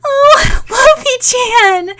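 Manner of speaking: high, loud delighted cry; excited exclaim. Acted. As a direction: call out to the puppy with joy. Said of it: "Sakura exclaimed".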